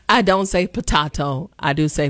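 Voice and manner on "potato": "potato" said the British way, puh-TAH-toe. Shown as a demonstration. Host teases her stickler mother, British "potato". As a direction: say "potato" in a British twang, ah in the middle. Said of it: "go back to my British twang".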